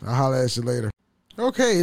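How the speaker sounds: ordinary talk, nothing marked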